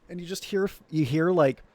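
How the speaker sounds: ordinary talk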